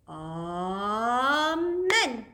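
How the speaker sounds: long swooping Amen, rising to the end; drawn-out Amen. Real, not acted. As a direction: stretch out the first syllable and slide upward. Closing a children's prayer together.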